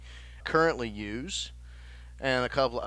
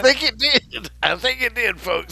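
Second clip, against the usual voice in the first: teasing laugh; 9 semitones higher, 9 dB louder than usual. Real, not acted. Teasing the lisp.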